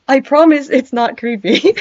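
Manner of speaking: laughing. Real, not acted. Still laughing after joke.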